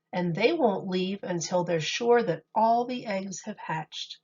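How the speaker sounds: gentle sing-song picture book reading; storybook voice for kids; reading to children. Acted. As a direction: read the rhymes to a little audience. Reads picture book aloud to children.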